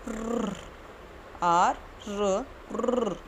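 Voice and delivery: र said softly, not a full र; quiet soft र, tongue barely touching. Shown as a demonstration. Teacher modelling a soft R for kids. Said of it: "इसकी sound हमें बनानी है र".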